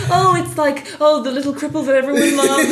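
high, loud mock-sentimental quote; mock gushing.

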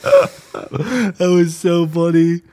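high, slow, squeezed out through laughter; cracking up while talking; helpless laughter. Real, not acted. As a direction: barely get it out for laughing. Cracking up remembering a funny video.

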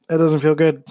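choking a little on water; strained after swallowing wrong; coughing up water. Real, not acted. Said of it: "that went down the wrong pipe".

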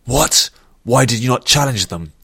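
irate general demanding answers; angry rebuke. A narrator's reading. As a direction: challenge a subordinate who failed. General rebukes nervous soldier.

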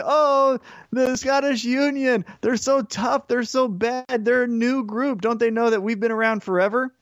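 high sing-song mockery; mocking high taunt. Mocking New Day taunt quoted.

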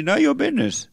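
high-pitched mock rebuff; sassy rebuff. Quoting old-time folks answering nosy questions, sassy rebuff. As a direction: imitate a quick brush-off, pitched well up. Quotes people in good old days.

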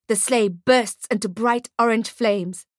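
usual speaking voice

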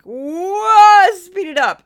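put-on loud, high, brash New York accent; New Yorker. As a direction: blurt it like an impatient New Yorker. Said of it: "as a New Yorker, I'm like".

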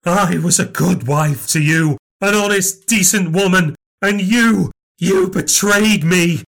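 furious grievance. Acted. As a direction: pour out a lifetime of betrayal. Long furious marital grievance.

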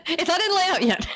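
squeaky-high words through laughter; laughing. Real, not acted. Co-host joking about last-minute book changes, laughing. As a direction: crack up while getting the line out.

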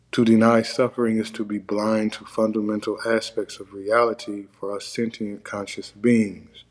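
ordinary talk

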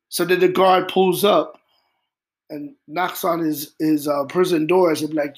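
usual speaking voice

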